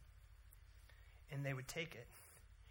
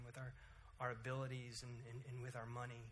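closing prayer. Acted. Pastor leads the congregation in a humble prayer.

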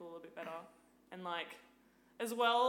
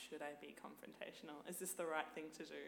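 soft, hesitant inner voice; second-guess yourself softly; quiet self-doubt; 3 semitones lower, 7 dB quieter than usual. Retold or imitated. Woman doubting herself at start, quiet inner voice. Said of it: "I'm like".

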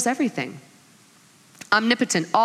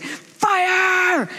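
high, drawn-out mock yell; angry battle command; 10 semitones higher than usual. Kylo Ren ordering the missiles fired at Luke, angry cry. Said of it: "you just feel the anger".

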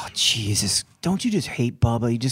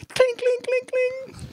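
high, slow sing-song bell sound; a door chime done with the voice; imitated door chime; slower than usual. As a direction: ring it out like a shop bell. Mimicking a shop door bell.

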